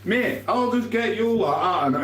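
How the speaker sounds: high, mocking threat; hammed-up playground threat; mocking tough guy. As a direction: send up his tough talk in a high voice. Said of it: "I have".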